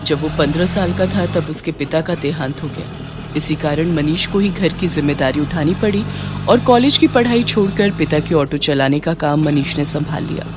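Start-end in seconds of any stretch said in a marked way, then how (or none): none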